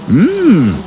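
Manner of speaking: very high, loud wasp voice; high buzzy wasp. A narrator's reading. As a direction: squeak it like a greedy wasp.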